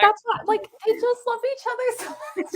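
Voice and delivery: laughing through the words, pitched high and quick. Real, not acted. Friend laughing while defending the couple to the group. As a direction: giggle while insisting they love each other.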